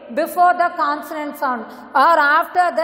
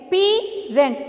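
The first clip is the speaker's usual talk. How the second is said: "Peasant" as two beats, pea high then zent; "Peasant" divided in two for learners; syllable by syllable; 4 semitones higher than usual. Shown as a demonstration. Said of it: "Two syllable".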